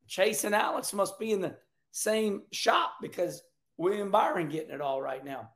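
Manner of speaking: ordinary delivery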